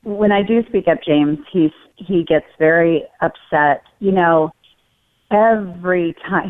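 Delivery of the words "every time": slow, drawn-out "every time", raised in pitch; stress on EVERY. Retold or imitated. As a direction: lean on "every" and stretch it out. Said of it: "he uses the word every".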